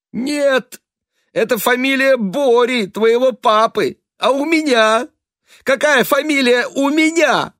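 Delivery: high, loud distraught mother; a distraught mother, near shouting; agitated mother. A narrator's reading.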